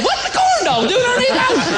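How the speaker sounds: high, goofy, put-on fish voice; dork fish voice. Dork fish pokes its head out of the bucket, high and goofy. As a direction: squeak it out like a dopey cartoon fish. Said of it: "and I said".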